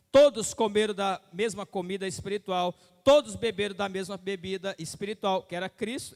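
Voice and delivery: fervent pulpit delivery; preaching. Acted. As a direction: preach it out over the congregation.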